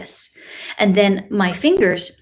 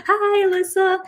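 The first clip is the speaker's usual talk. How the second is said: high, louder delighted thank-you; 12 semitones higher, 5 dB louder than usual. Real, not acted. Teacher gushes thanks to a viewer in the chat. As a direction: gush with thanks.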